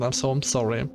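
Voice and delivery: normal talking voice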